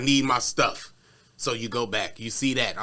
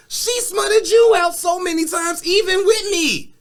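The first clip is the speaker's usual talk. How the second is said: heated rant; 9 semitones higher than usual. Retold or imitated. Tory yelling at her in the car, furious. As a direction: rage at her for ruining the night. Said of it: "Tory heated".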